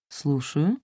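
low, melodious female phone voice; melodious woman's voice. A narrator's reading. Stranger answering the narrator's phone call, melodious and low. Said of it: "мелодичный женский голос".

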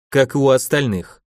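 usual speaking voice

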